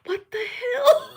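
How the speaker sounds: high, slow, laughing disbelief; astonished. Retold or imitated. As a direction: squeal it in mock shock, then burst out laughing. Retelling shock, breaks into laughter.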